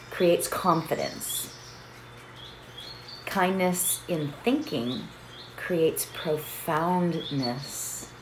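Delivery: slow, measured recital with long pauses; reverent quotation. Real, not acted. Teacher reciting Lao Tzu on kindness, slow and reverent. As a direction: let each phrase settle, slow and softening.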